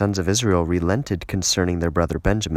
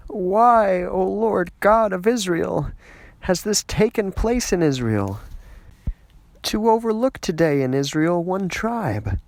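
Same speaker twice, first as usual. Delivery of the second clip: high, wailing cry to God; keening prayer of a grieving people; weeping lament; 11 semitones higher, slower than usual. Acted. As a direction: plead through tears, voice lifted high. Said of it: "lifted their voice and wept a great weeping".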